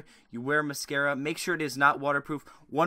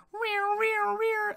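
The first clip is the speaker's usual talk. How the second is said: high wailing air horn noise; air horn imitation; much slower than usual. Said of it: "imagine with, uh, air horns playing".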